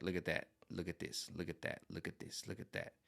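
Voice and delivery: fading quiet pointing. Retold or imitated. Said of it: "I can be like".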